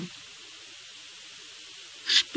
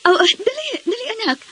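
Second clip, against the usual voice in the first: flustered; 8 semitones higher, faster than usual. Acted. Mother startled, hurrying daughter.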